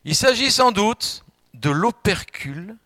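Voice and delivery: high, loud and slow, reading the dictionary aloud with mock gravity; preacher quoting the dictionary to the congregation, loud and slow; solemn quoting read. Shown as a demonstration. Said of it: "Je cite, écoutez-moi".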